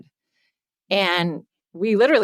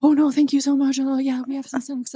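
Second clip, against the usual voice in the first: lightly voiced, higher and hurried politeness; flustered apologetic husband; 7 semitones higher than usual. Imitating Brett thanking the woman, higher and flustered. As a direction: play the mortified husband smoothing it over. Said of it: "poor Brett is mortified at any conflict so he was like".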